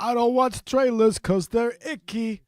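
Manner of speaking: lightly hammed slicker podcast host; a hint of a smooth studio host; mock polished host. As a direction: parody the cleaner new show. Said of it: "here's what it sounds like now".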